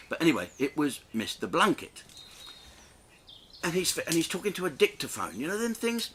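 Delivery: ordinary talk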